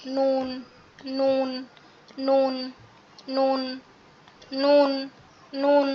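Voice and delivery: নুন with a nasal hum, tongue tip on the palate; Arabic letter nun. Shown as a demonstration. Teacher modelling নুন for the class. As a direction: hum the নুন through the nose. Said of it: "এটার মতো উচ্চারণ".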